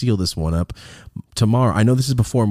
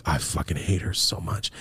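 low, soft retold confession; quiet mock venom, dropped low; hushed loathing; 4 semitones lower, 8 dB quieter than usual. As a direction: imitate him muttering his hatred. Husband's confession voiced again, hushed.

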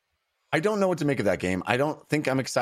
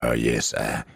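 mock game developer pitch; 8 semitones lower than usual. Podcaster mocks a developer selling his game, in a low suave pitch. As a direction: purr the sales pitch like a suave presenter. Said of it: "I'm really digging the".

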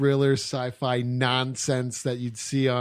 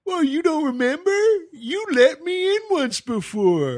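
movie character voice; 13 semitones higher, 3 dB louder than usual. Retold or imitated. Retelling a movie character's line.

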